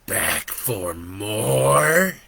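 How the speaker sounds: very slow sneering devil voice; devil taunting. Acted. Devil taunts Joe for coming back, very slow. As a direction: drag it out, mocking him. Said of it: "the devil taunted".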